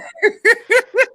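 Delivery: cracking up high and loud; high-pitched burst of laughter. Real, not acted.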